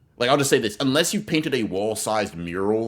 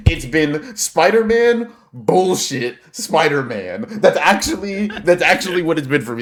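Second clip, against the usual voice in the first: louder and higher, slow exasperated rant; 7 semitones higher, 8 dB louder than usual. Real, not acted. Telling the listener about his Spider-Man obsession, exasperated and loud. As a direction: vent it out big.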